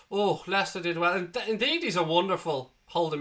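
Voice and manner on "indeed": ordinary talk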